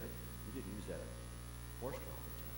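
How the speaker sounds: soft, low, half-whispered dread; wife asking in hushed horror. Retold or imitated. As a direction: ask it in quiet horror. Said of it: "She said".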